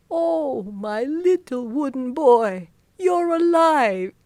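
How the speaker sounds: put-on high, slow overjoyed old man; joyful high cry. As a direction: marvel at it, voice lifted high. Geppetto overjoyed at living puppet.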